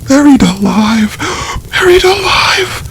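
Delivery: terrified shriek climbing very high. A narrator's reading. Sister realising her brother's fate, rising terrified shriek. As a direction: scream it, rising in horror on the repeat. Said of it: "She shrieked out".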